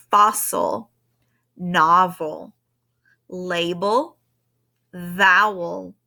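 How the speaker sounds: one word at a time, evenly spaced with pauses; reading word list aloud. Shown as a demonstration. Teacher reading the week's spelling list to students, measured list reading. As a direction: read out each spelling word separately and carefully. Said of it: "I'll read them out loud".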